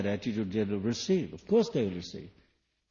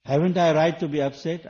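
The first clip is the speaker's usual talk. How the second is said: complaining demand; 5 semitones higher, 8 dB louder than usual. Retold or imitated. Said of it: "I said".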